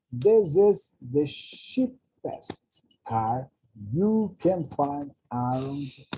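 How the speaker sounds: very slow, careful English model sentence for students; slow model reading. Shown as a demonstration. Teacher reading the English example sentence slowly to the class. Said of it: "presta atenção nos elementos da frase".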